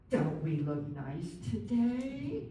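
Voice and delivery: put-on slow, sing-song baby talk to an adult. Shown as a demonstration. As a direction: coo it slowly, as if to a small child. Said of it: "baby talk".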